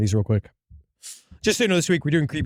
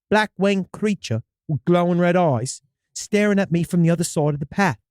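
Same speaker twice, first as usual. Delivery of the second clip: storyteller in a put-on British accent; hammy English-accented first-person tale. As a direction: read it with an amateur British accent. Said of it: "Can you stick with this accent".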